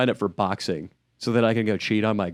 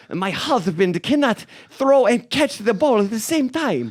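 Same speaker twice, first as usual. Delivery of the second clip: high, slow put-on voice of an indignant wife; hammed-up offended wife; Giselle; 9 semitones higher, slower than usual. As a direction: snap back in a high imitation voice, drawing the words out. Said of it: "Giselle is like".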